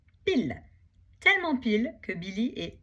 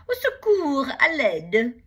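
hammed-up wail, much higher and louder; fake cry for help; 6 semitones higher, 11 dB louder, slower than usual. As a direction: play the wounded victim, loud. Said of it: "se met à gémir, comme s'il était blessé". (faux appel au secours, exagéré)

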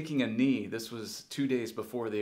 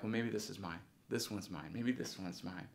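low, soft aside to oneself; quiet hopeful thought; 4 semitones lower, 10 dB quieter than usual. Retold or imitated. Kid waiting through award after award, quiet hopeful thought. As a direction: think it to yourself, low and soft.

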